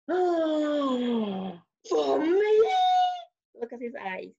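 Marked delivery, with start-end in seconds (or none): 0.1-2.7 so excited. Acted. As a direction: gasp it out high, thrilled at the gift. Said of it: "He's, like, so excited".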